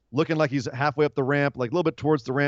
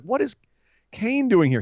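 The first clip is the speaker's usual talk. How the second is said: higher, puzzled disbelief; surprised; 8 semitones higher than usual. Retold or imitated. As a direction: ask it baffled. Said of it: "I was like".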